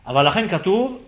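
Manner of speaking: ordinary talk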